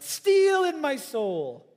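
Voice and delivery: slow, high and loud, in Lennox's voice; imitating Lennox. Preacher echoing Lennox's words, slow, high, loud. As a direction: put on the old scientist's voice, stretch steel. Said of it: "You can hear him saying that".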